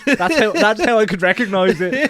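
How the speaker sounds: loud, high, amused outburst; laughing. Real, not acted. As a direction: shout it through laughter. Both hosts laughing over recognition.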